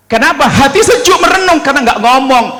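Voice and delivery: high, loud, scolding sermon; preacher acting out a quarrel; fiery preaching. Preaching at full force. (ceramah berapi-api, nada tinggi)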